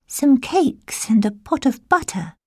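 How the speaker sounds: put-on high, innocent child voice; little girl voice. A narrator's reading. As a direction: answer sweetly like a girl. Girl answers.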